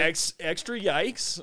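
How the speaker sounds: slow, high, drawn-out yikes; extra yikes. Retold or imitated. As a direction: stretch out the disbelief. Escalating the reaction.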